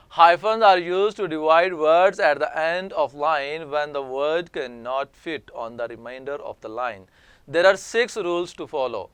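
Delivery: slow, deliberate dictation reading; careful classroom reading voice; slow read-aloud. Shown as a demonstration. Teacher reads rule text aloud.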